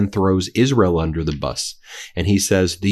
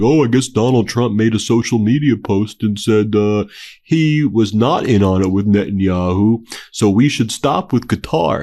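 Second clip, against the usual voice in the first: sarcastic mock of a naive Iranian reply, a bit louder; 4 dB louder than usual. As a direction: put on a silly naive voice to ridicule the idea. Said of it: "you think Iran's gonna be like".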